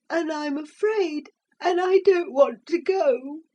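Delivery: put-on high, small frightened child voice; high boy voice, afraid; scared boy. A narrator's reading. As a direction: admit the fear.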